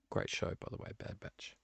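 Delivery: unvoiced murmured aside; whispered aside. Real, not acted. Host whispering an aside about a TV show. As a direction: whisper it to yourself.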